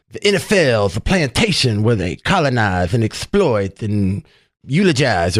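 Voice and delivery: mocking a star's new speechy accent; mock Kaepernick Netflix accent. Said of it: "when he developed an accent for his Netflix series".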